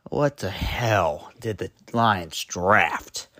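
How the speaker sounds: low, slow, disbelieving groan of a fed-up fan; exasperated fan. Retold or imitated. Fed-up Lions fan at his TV, slow and low. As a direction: drag it out like a fan who can't believe the pick. Fan voicing imagined frustrated viewer.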